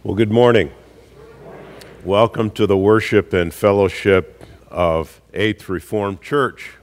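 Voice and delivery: ordinary delivery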